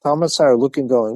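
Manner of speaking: usual speaking voice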